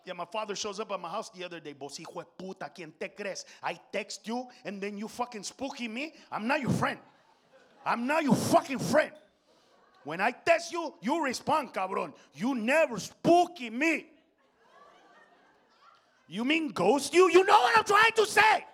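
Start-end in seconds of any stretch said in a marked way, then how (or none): 1.7-14.1 imitating an angry dad with a thick Spanish accent; father's thick accent. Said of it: "My father's accent is so thick". 16.3-17.3 loud, raised, drawn-out question; loud correction. Retold or imitated. Son loudly corrects angry father. 17.3-18.6 put-on high, full-blast yell in a Spanish accent; exasperated father shouting. Acted father yells back.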